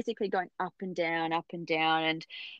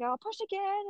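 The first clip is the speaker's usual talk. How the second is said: much higher, softer, sing-song push again; coaching doctor; 12 semitones higher, 8 dB quieter than usual. Retold or imitated. Obstetrician asking Tracy to push again, high and soft. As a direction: lightly coax another push.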